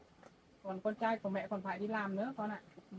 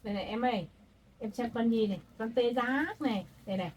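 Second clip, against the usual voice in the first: put-on loud, sing-song talk to a toddler; grandma playing and showing toys to a baby; 10 dB louder than usual. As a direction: coax the baby, calling out brightly.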